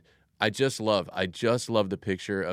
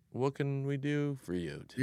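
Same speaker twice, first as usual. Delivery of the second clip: soft, higher, unhurried voice; gentle, kindly offer; 6 semitones higher, 7 dB quieter than usual. Acted. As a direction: offer help tenderly, untroubled by the noise. Jesus gently answers amid the crowd's shouting.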